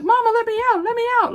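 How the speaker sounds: high, loud whiny plea for the trapped dogs; dogs begging. Retold or imitated. Her dogs pleading through the fence, high and whiny. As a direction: voice the puppies begging to get out.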